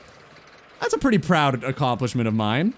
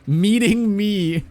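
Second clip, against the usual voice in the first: mock smug; 5 semitones higher, 4 dB louder, much slower than usual. Joking answer for Ludi.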